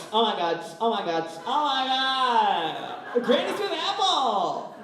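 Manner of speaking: hammed-up candle-store ecstasy; cracked-out shopper. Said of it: "They're like".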